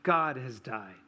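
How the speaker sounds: deadpan, toneless delivery; said flatly. Retold or imitated. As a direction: let the words drop without feeling. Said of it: "and said flatly".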